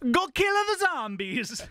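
hammed-up Italian accent, drawn out; a hint of a TV actor in a mock Italian accent. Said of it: "I'm trying on this new Italian accent".

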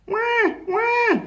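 very high, drawn-out wail; squeaky animal wah; baby seal cry. A narrator's reading. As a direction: bleat like a lost baby seal. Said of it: "cried the baby seal".

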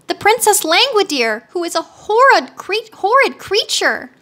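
put-on high, indignant girl's complaint. A narrator's reading. Captive girl complains about princess.

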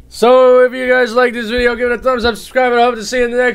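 high, loud, fast YouTuber sign-off; excited outro. Real, not acted. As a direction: pump up the channel outro. Outro to viewers.

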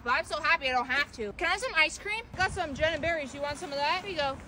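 ordinary talk

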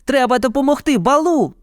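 put-on high, urgent child voice; boy's acted cry. A narrator's reading. Boy wants to help the bear, high cry. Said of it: "кричав Мауглі".

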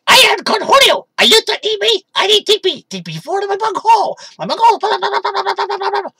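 very high, loud, manic cartoon voice; Cornholio. Showing viewers his Cornholio impression, shrieking. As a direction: shriek it like a crazed cartoon teen. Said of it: "I can do my Cornholio impression".

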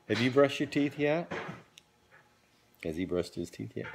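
usual speaking voice

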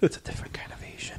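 fast whispered aside; whispering. Real, not acted. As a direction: whisper it quickly under your breath. Quick aside to the group.

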